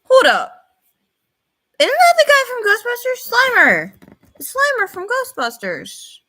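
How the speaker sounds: high-pitched, loud burst of realization; can't believe you recognized it, voice jumping up; excited recognition. Real, not acted. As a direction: blurt it out in real excitement. Spots a familiar movie ghost.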